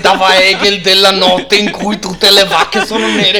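loud, high, outraged professor voice; Cacciari impression. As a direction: lecture them with theatrical indignation. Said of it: "l'imitazione di Cacciari".

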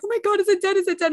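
fretting over whether it is dead; alarmed. Retold or imitated. Owner checking the baby rabbit, high alarmed worry.

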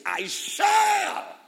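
very high, loud "I shall"; emphatic declaration. Acted. Preacher declaring scripture to the congregation, loud and high. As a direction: shout "shall" with conviction.

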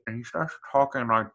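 muffled masked villain voice; lightly done muffled Bane impression. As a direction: talk as if through a mask like Bane. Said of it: "it's like the Bane voice almost".

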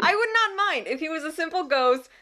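high, loud laughing rant. Real, not acted. Laughing about ghost creep.

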